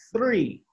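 "three" with a th, not a t; correct th. Shown as a demonstration. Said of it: "tenemos que decir".

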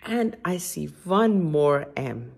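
plain reading voice

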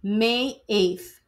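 ordinary delivery

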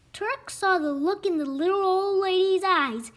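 usual speaking voice

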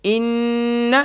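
ان said short, not stretched. Shown as a demonstration. Teacher shows ان should not be lengthened. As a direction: clip ان, do not draw it out. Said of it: "ان کو کھینچنا نہیں ہے".

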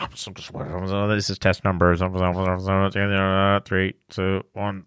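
low, muffled official countdown voice; test-video announcer. Acted. Video announcer counting down to the blast, low and muffled. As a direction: drop right down into a slow test announcer's mumble.

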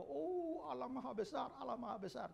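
a hint of exaggerated piety; mock pious praise. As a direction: parody a showy worshipper. Mocking a hypocrite's praise.

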